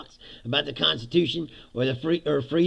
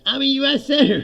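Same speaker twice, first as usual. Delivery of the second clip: near-shouted repeat of his title, louder and higher; louder protest; 9 semitones higher, 9 dB louder, faster than usual. Retold or imitated. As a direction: cry it out as they shove you through the door. Repeated as pushed out door.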